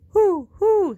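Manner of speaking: high, loud hooting; loud high owl hoots. A narrator's reading. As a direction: hoot it out like an old owl. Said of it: "hooted the owl".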